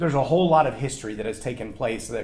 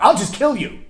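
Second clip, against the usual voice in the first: high, loud playroom threat; loud mock child threat; kid's threat; 8 semitones higher, 7 dB louder than usual. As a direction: shout it like a squabbling child. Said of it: "hear someone say something like".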